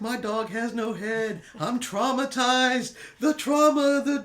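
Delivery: high, slow, hammed-up despair; mock traumatized. Joking guest to steward, melodramatic mock trauma. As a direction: play the trauma big and theatrical. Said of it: "just making it all dramatic".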